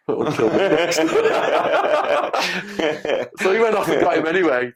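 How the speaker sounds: words breaking into real laughter; giggling as he tells it; laughing. Real, not acted. Laughing while retelling prison story.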